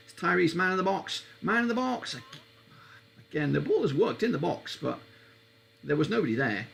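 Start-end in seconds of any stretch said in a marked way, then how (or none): 0.1-2.2 higher and higher on man in the box; excited call. Real, not acted. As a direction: call the chance as it builds. Live attack, calling it.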